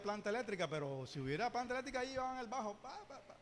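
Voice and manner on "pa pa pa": soft muttered bass plucks; mock bass line. Pastor joking about a bass at David's worship: soft mock bass sound.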